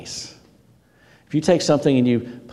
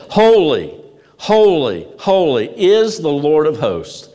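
loud, raised cries with falling endings; proclaiming shout; 4 semitones higher, 5 dB louder, slower than usual. Acted. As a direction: call it out slowly, like angels crying to one another. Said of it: "One shouts it".

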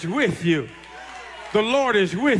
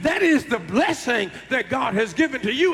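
high, ringing preacher's cry; full-force preaching; 5 semitones higher than usual. Acted. Preacher to congregation, rising full-force preaching. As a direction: lift the church with it.

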